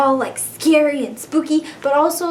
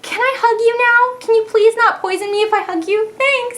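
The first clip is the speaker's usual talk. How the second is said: much higher, sugary asking voice; sweet pleading; 10 semitones higher than usual. Retold or imitated. Asking Oddish for a hug, high and sugary. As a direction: coo at it like a cuddly pet. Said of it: "And I'm just like".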